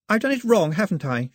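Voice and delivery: put-on high, worried, fretting young girl; anxious girl. A narrator's reading. Girl asking her guest if the bread failed, high and worried. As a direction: fret that you ruined the bread.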